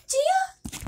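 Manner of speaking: high called name; calling out. Real, not acted. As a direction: call the cat's name up high. Calling out to the pet.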